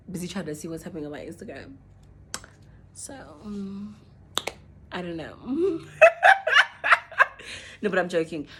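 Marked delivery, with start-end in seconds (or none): 5.9-7.8 high, loud burst of laughter. Real, not acted. After joking about her ex, a high loud laugh. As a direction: break into a big laugh.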